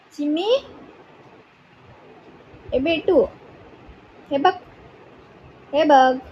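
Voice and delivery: high, sing-song pet talk. Real, not acted. Owner soothing her anxious puppy, high cooing baby talk. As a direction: coo to coax the puppy.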